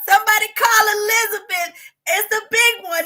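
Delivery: clutch your chest, wail; Redd Foxx joke. Retold or imitated. Redd Foxx heart attack joke.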